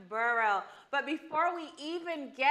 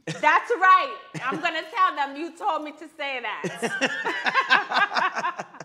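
laughing; 3 semitones higher, 7 dB louder, much slower than usual. Real, not acted. Joking banter, laughing.

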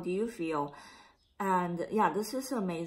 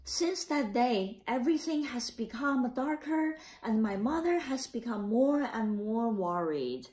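put-on a gentle read-aloud for children; story narration; 4 semitones higher than usual. A narrator's reading. Teacher reading the class a picture book, gentle narration.

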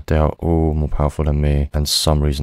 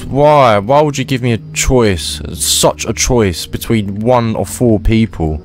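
whiny protest; 7 semitones higher than usual. Real, not acted. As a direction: plead against the impossible choice. Protesting the cruel choice.

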